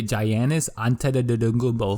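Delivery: "Giannis Antetokounmpo" as jai-AN-is anta-duh-duh-goombo; mock people mangling "Giannis Antetokounmpo" with a hard J and babbled syllables; imitated mispronunciation. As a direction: garble "Giannis Antetokounmpo" like a clueless reader. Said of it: "try saying Giannis Antetokounmpo's name".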